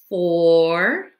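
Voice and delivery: plain reading voice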